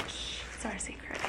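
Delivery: soft secret whisper to the dog. Real, not acted. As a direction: hush it like a secret.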